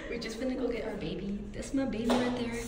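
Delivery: normal talking voice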